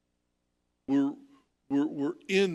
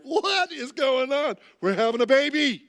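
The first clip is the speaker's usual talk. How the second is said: stunned shout; 10 semitones higher, 7 dB louder than usual. Retold or imitated. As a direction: blurt it out caught completely off guard. Husband stunned as nurses rush.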